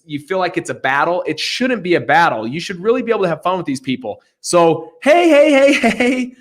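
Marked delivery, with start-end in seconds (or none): 5.0-6.3 high, loud, playful calling out; playful hey. Acted. Host teasing the seller in a mock negotiation, playful high calling out. Playful call to the other side.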